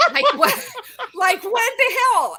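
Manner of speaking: high, laughing outrage; laughing disbelief. Real, not acted. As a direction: laugh out what the hell. Laughing at the absurd interview.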